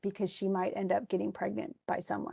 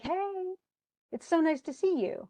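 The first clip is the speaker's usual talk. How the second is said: happy greeting; 8 semitones higher than usual. Retold or imitated. Old school friends greeting Hamlet on arrival, high and happy. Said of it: "He's all happy to see them".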